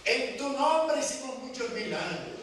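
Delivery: high-pitched mock appeal to the Lord; pleading crowd at judgment. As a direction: cry it out high, as people pleading their case. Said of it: "muchos me dirán".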